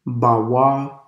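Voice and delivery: ordinary delivery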